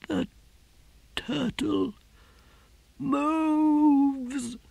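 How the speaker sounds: a last defiant breath; prisoner's final words to his torturer; faint dying prisoner. A narrator's reading. Dying prisoner and whispering inquisitor exchange.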